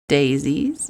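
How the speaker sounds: normal talking voice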